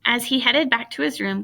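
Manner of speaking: ordinary delivery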